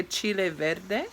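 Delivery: chile verde in a Spanish accent; light Spanish accent on chile verde. Shown as a demonstration. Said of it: "with my Spanish accent".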